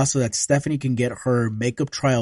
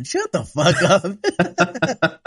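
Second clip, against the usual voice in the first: mock disbelief; 4 semitones higher, much slower than usual. Mocking reaction.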